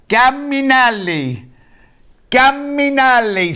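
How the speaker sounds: high, slow sing-song call to the dog. Real, not acted. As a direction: stretch it out, calling a pet. Calling the dog in Italian.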